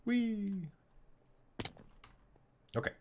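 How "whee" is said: high, drawn-out little "whee"; a playful high-pitched squeal of glee. Pretend. Playful cry while playing.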